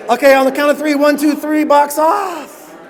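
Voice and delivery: loud, high call to a crowd. Real, not acted. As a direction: call it out to the whole room. Calling out to the room.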